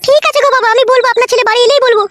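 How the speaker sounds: put-on high-pitched, dutiful young wife voice; obliging daughter-in-law. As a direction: agree obediently in a high put-on voice.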